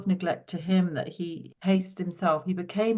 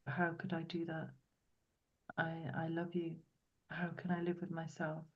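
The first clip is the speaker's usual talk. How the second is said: mild tearful remorse; 14 dB quieter than usual. Retold or imitated. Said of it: "now he's crying".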